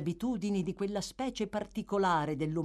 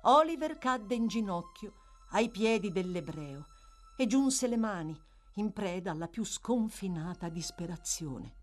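ordinary delivery

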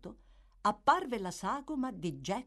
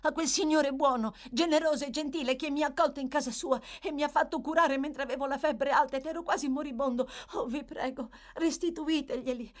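put-on high, fast, urgent child's begging; desperate pleading; 9 semitones higher, 3 dB louder, faster than usual. A narrator's reading. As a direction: beg in rising panic. Oliver begs to return the books.

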